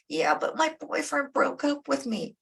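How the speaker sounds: high whiny excuse; whiny teenager. Retold or imitated. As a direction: whine it like a teen dodging a chore. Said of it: "you got the whiny teenager".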